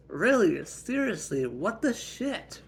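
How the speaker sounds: high, loud, swooping disbelief; indignant protest. Acted. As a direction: protest each word, outraged. Slapped sister protests.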